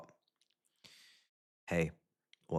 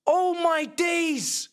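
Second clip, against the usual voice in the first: exasperated groan; 6 semitones higher, 3 dB louder, much slower than usual. Real, not acted. Despair after disallowed goal.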